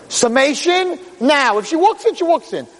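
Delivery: high, insistent courtroom command; impatient judge's order. Acted. Judge ordering the lawyer to sum up, impatient and insistent.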